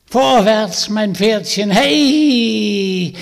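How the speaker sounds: high, loud witch voice driving her steed on; witch urging her mount. Acted. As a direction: spur the oven on like a wild hag. Hexe treibt ihren Ofen an. (Hexe treibt den Ofen an, hoch und laut)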